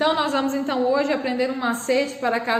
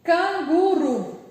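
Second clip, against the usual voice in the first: Canguru said slowly and higher; slow, clear model word for pupils; careful example word; 4 semitones higher, much slower than usual. Shown as a demonstration. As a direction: draw out each syllable of Canguru. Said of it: "um outro exemplo".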